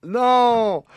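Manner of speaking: high, loud shout of no; loud exclamation. Real, not acted. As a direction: burst out with a sharp no. Quiz host rejects the answer.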